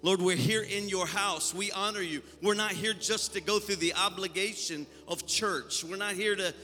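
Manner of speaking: fervent prayer then rising loud exhortation; preaching. Acted. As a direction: lift the church to praise.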